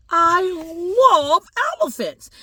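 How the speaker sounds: put-on high, slow, drawn-out kid's gush; gushing excitement. Little boy tells family his love of elephants, gushing.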